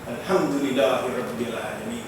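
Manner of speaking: ordinary delivery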